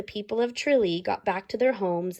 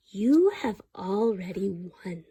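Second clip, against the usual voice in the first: mysterious stranger; 3 semitones lower, slower than usual. Acted. Leprechaun's remembered words to Fiona.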